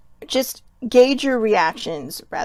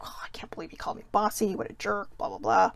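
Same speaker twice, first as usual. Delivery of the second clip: muttered rant; 7 dB quieter than usual. Retold or imitated. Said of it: "but I'd be like".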